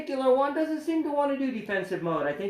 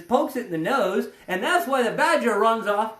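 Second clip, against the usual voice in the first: ordinary talk, nothing marked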